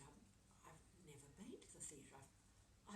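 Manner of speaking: whispered, hushed admission; a soft whisper of awe; awed whisper. Retold or imitated. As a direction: breathe it like a shy confession. Bus driver answers, awed and hushed.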